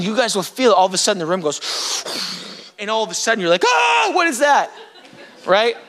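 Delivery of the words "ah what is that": high gasped exclamation; acted astonishment, pitch jumping up; startled awe. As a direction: react as if suddenly overwhelmed. Acts congregation suddenly sensing God's presence.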